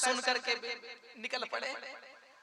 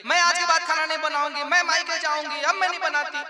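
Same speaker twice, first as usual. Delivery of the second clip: loud, higher wailing protest; shouting; 7 semitones higher, 7 dB louder than usual. Acted. Slapped wife shouts that she won't cook, loud and higher. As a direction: shout it so the guests hear. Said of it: "जोर से कहने लगी".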